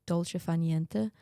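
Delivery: ordinary talk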